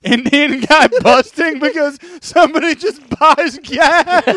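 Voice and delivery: high, breaking up with real laughter; laughing hard. Real, not acted. Podcaster repeats co-host's joke, laughing helplessly. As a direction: squeeze the words out through laughter.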